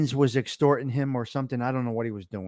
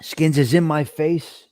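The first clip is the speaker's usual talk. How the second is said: ordinary talk, nothing marked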